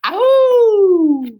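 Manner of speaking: very high, loud drawn-out howl; dog howl at full speed; long howl. Acted. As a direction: let out a long wild howl. Dogs howling racing downhill.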